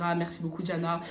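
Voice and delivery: ordinary talk, nothing marked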